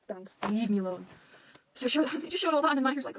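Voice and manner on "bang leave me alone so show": ordinary talk, nothing marked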